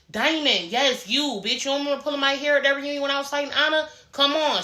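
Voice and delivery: high, insistent imitated challenge; calling someone out. Retelling a castmate confronting Diamond over hair pulling, high-pitched call-out. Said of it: "Summer had to call Diamond out".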